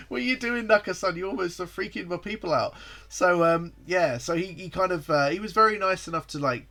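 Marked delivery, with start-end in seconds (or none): 0.1-2.7 high, amused scolding; laughing tease. Real, not acted. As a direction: playfully scold him through a laugh. Teasing Naka-san loudly.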